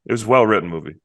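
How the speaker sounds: normal talking voice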